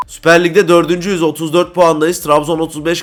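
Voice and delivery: ordinary talk, nothing marked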